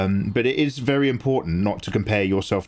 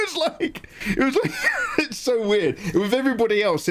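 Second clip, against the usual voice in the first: high-pitched laughter through the words; laughing; 13 semitones higher than usual. Real, not acted. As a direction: crack up mid-sentence. Cracking up about Nathan's book.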